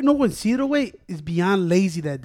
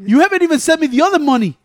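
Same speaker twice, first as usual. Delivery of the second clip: raised, louder complaint; annoyed retold rant; 6 semitones higher, 6 dB louder than usual. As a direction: throw the accusation out loud and high. Retelling his rant at a friend.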